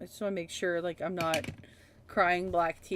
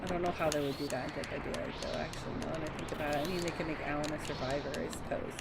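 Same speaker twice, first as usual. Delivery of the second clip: softer, lower and fast; thinking aloud to yourself; muttered aside; 5 semitones lower, 6 dB quieter, faster than usual. Real, not acted. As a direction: mumble it under your breath. Muttering to self.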